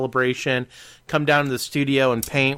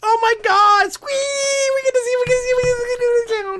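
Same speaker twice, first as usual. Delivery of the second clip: shrieking, far higher and louder giddy squee; squealing excitement; 5 dB louder than usual. Retold or imitated. Host mimicking the husband squealing about the trip. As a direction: squeal like a fangirl. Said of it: "her husband has just been like".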